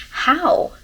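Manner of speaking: sharp high disbelieving question; can't believe what she just read; baffled how. Real, not acted. Reading a twist to her viewers, baffled disbelief.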